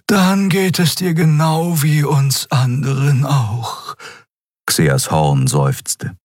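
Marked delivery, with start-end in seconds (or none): 0.1-3.9 high, slow creature voice; slow and high, a bit wry; character voice. A narrator's reading. Xea replies.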